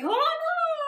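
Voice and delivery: put-on high voice to stall someone; high-pitched protest. Teacher voices disciples stopping the donkey's owner, high and loud.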